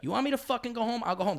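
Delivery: high, loud angry outburst; shouting at his friend, high; retold yell. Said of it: "I used to say that".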